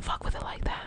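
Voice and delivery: embarrassed whisper. Real, not acted. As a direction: whisper it as if ashamed. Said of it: "I have to whisper 'cause I'm so embarrassed".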